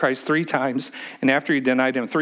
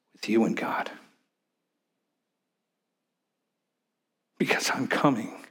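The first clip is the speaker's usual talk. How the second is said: softer, whispered plea in Jesus' voice; whispered words of Jesus; 4 semitones lower, 5 dB quieter than usual. Acted. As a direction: hush as if Jesus confides it. Voicing Jesus' words to Jerusalem.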